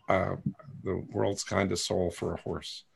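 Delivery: normal talking voice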